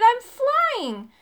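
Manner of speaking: high, loud, thrilled exclamation; exhilarated yell; excited shout. A narrator's reading. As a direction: cry out with the thrill of taking off. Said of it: "I shouted".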